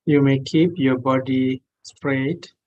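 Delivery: ordinary talk, nothing marked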